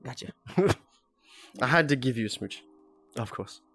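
usual speaking voice

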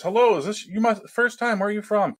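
high, eager imitation of the restaurant owner; imitating the owner. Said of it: "the owner says".

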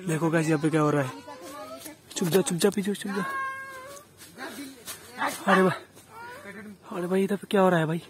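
quiet, tense whispers while watching; hushed frightened narration; hushed and scared. Real, not acted. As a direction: keep your voice down, you're afraid of being heard. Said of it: "डर लग रहा है मेरे को".

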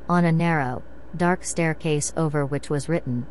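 plain reading voice

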